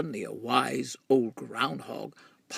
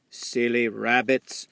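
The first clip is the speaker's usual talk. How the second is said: owl voice; 7 dB louder than usual. A narrator's reading. Owl answers the rabbit.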